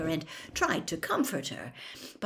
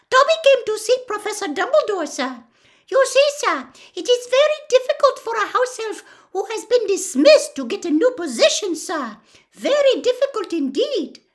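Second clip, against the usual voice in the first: high, loud squeaky elf voice, fast; an eager elf explaining his plight; earnest house elf; 9 dB louder than usual. A narrator's reading. Dobby explaining to Harry, high and squeaky.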